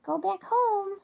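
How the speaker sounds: high, coaxing call to a dog; shooing a dog home. Retold or imitated. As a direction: send the little dog home, sweetly but firmly.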